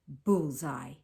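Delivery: a hint of a hunter gloating over his find; crowing. A narrator's reading. Hunter finding giraffe tracks, mildly crowing. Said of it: "he crowed".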